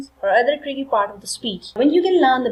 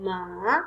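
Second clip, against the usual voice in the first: "Ma" going low, then back up; dipping third tone; 4 semitones lower, 6 dB quieter than usual. Shown as a demonstration. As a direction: dip the pitch on "Ma", then raise it. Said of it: "going down and up".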